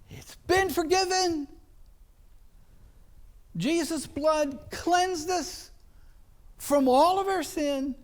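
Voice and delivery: rising revival-preacher cry; preaching at full force. Acted. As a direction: lift it up and proclaim it to the church. Climactic preaching about forgiveness.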